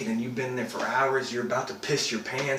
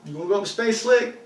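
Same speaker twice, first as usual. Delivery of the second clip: higher, louder boss's call; old boss; 8 semitones higher, 6 dB louder than usual. Retold or imitated. Old boss calls out to his eager astronaut employee, raised imitated voice. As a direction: imitate the boss, pitched up and punchy. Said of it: "he was always like".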